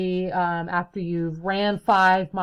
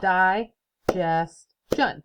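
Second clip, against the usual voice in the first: very slow, each syllable pulled apart; slow recount; much slower than usual. Shown as a demonstration. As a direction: count the beats again carefully. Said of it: "Miss Lawton made a mistake there".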